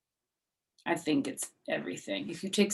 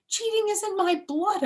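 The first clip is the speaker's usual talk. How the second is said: put-on much higher kid voice; dramatic little girl; 7 dB louder than usual. Junie B confiding to Mister Scary, much higher.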